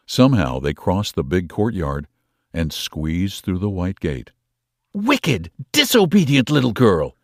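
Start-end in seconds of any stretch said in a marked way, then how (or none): 4.9-7.2 louder, bitter aunt's reproach; bitter aunt scolding her niece. A narrator's reading. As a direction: spit the words at the child. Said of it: "said Aunt Beryl bitterly".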